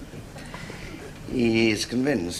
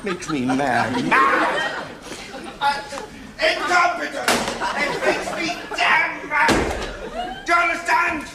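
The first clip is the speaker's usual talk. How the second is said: furious shouting. Acted. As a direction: lose your temper completely and yell. Furious doctor rants at assistant.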